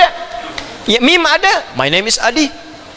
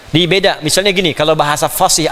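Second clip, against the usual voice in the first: normal talking voice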